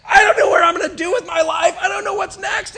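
high, loud sobbing blurt; tearful outburst. Retold or imitated. Pastor retelling crying to a man of God, loud tearful outburst. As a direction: blurt it all out through tears. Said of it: "tears rolling down my eyes".

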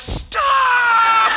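very high, loud yell at yourself; inner shout. Retold or imitated. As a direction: scream at yourself to stop. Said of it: "you're like".

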